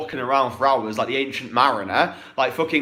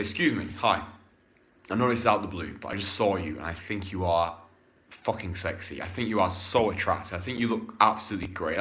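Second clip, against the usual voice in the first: much lower and softer, confident line to a woman; low confident pickup line; 6 semitones lower, 5 dB quieter than usual. Shown as a demonstration. Coach demonstrating a pickup line, low and confident. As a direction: say it low and sure, no neediness. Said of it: "Do you see how I'm doing this".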